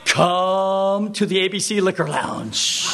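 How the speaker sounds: slow, drawn-out droning call; hammed-up sing-song invitation; mocking mimicry. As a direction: parody the drawl right back at him. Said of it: "He just exaggerates it".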